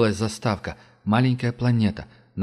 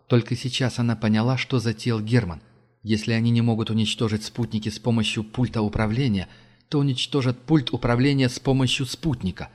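plain reading voice

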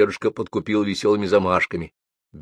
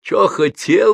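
put-on much higher, louder menacing old man; howling; 11 semitones higher, 5 dB louder, slower than usual. A narrator's reading. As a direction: howl it back, mocking the boy. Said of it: "взвыл старик". (взвыть высоко и громко, передразнивая)

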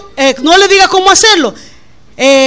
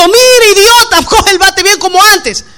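mock yelling; 5 semitones higher than usual. Girlfriend yelling batting advice from the stands, mock shout. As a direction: bark the order at him, hammed up. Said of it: "ella no le gritó".

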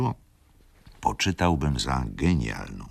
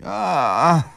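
groan; 7 dB louder than usual. A narrator's reading. Old lady hearing the husband may be found, pained groan. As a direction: let out an unhappy "A, a". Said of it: "jęknęła starsza dama".

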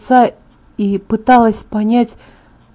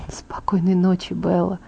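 soft whispered good night; 2 semitones lower, 7 dB quieter than usual. Acted. Edward whispers good night to Bella to end the talk. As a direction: let it out on a whisper.

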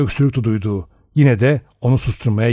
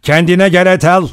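sharp order, higher and louder; commanding; 6 semitones higher, 5 dB louder than usual. A narrator's reading. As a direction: order them to pull themselves together. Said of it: "Rogers'ın sesi bir buyruk gibiydi". (buyurgan, yüksek sesle)

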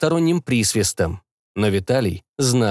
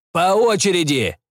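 calling out; 8 semitones higher than usual. Acted. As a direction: shout to them across the ship. Commander shouts to the cabin.

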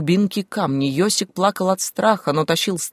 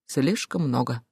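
low, soft repetition; subdued echo; 5 semitones lower, 6 dB quieter, slower than usual. A narrator's reading. (тихо, подавленно)

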